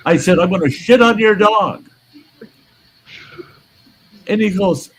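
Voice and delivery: retold rant. Ranting at the neighbour about the dog, heated. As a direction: lecture him, building outrage.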